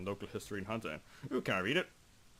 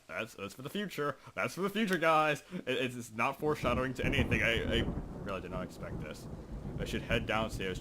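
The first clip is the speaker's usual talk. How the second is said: normal talking voice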